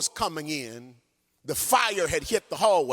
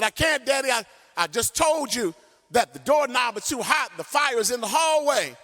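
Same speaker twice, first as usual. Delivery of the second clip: put-on frightened child; 6 semitones higher than usual. Trapped child answers father.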